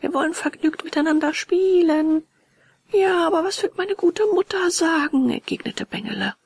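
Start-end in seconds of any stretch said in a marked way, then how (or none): 0.0-2.3 high-pitched, cheerful call; eager calling, pitched well up; children's shout. A narrator's reading. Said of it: "schrien alle aus dem Wagen". 2.9-5.3 high, louder anxious protest; high-pitched, fretful child's objection; worried boy. A narrator's reading. Torn boy voiced in story.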